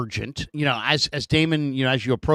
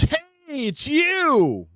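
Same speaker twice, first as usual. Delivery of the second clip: high, excited stranger's call; fan's greeting; much slower than usual. Retold or imitated. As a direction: squeal it like an eager fan spotting you. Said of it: "and he goes".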